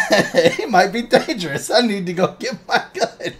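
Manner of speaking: high, giggly mock alarm; giggling fake panic; laughing mock worry. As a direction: play scared through laughter. Jokey mock paranoid gun owner.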